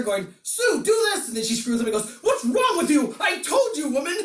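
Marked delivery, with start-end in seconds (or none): 0.4-1.3 high, hammed-up shouted order; mock yelling. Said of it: "like, yelling at her". 2.2-4.2 high-pitched, hammed-up scolding; mock angry yelling. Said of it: "like, yelling at her".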